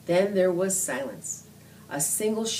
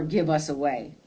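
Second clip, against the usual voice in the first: ordinary delivery, nothing marked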